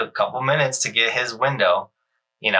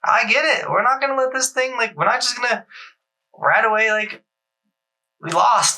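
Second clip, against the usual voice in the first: much higher, fired-up pep talk; dad pep talk; 9 semitones higher than usual. Retold or imitated. Dad rallying his son at the side of the jump with a much higher, fired-up pep talk. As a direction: pump the kid up to hit the jump again. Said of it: "I was like".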